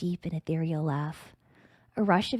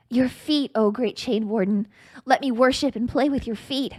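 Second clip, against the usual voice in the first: louder, higher eager plea; eager pleading; 5 semitones higher, 7 dB louder than usual. Acted. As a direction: beg him with sudden eagerness. Eager repeated plea to Thresh.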